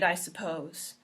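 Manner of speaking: ordinary delivery, nothing marked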